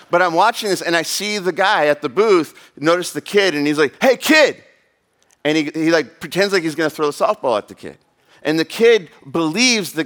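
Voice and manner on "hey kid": loud, high-pitched taunting call; sudden sharp yell; carny calling out. Retold or imitated. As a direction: holler it at a kid passing the booth.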